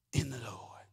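hushed whisper of the phrase. Acted. Preacher hushes the congregation, whispering. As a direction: breathe it out barely voiced.

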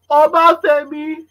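put-on high, slow call of the child's name; mother calling her child; parent calling out. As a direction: summon the kid from another room.

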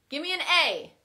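loud cheerleader chant; cheer. Shown as a demonstration. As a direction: shout it like a cheer squad. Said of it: "So let's try that".

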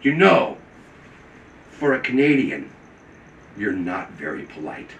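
retold withering remark; deliberately rude. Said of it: "it was the rudest thing".

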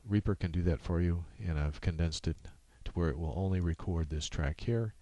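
ordinary delivery

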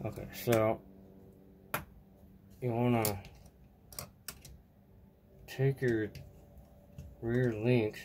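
talking with a screw in mouth; words round a screw held in teeth; mouth full. Real, not acted. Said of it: "Sorry, I had a screw in my mouth".

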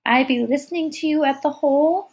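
put-on high little toddler voice; small child. A narrator's reading. Tyke recalls the keyhole to Kira, small child's voice. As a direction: speak as a tiny tyke remembering. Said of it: "she spoke around it".

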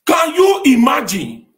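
high, slow and very loud disbelief; outraged shout. Real, not acted. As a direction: bellow it out, scandalised. Outraged exclamation in rant.

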